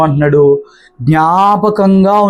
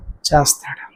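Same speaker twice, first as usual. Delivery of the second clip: hushed, breathy whisper of faith; whispered assurance after a pause; 5 semitones lower, 8 dB quieter, slower than usual. Real, not acted. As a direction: drop to a whisper, confiding. Preacher drops to hushed assurance.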